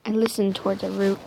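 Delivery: ordinary delivery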